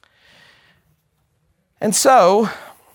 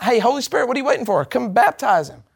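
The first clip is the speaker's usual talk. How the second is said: higher-pitched mock call-out; quick, higher, absurd request; mock summons; 4 semitones higher, faster than usual. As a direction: pretend to hail someone who is dawdling. Mock summons to the Holy Spirit.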